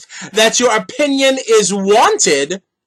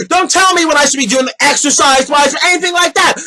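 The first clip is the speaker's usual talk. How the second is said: ordinary talk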